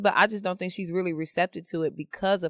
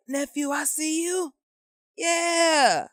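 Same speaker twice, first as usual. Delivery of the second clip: high-pitched drawn-out admirer's call; mock catcall; 9 semitones higher, much slower than usual. Imitating a flirtatious admirer calling out.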